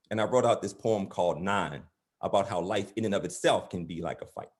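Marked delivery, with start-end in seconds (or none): none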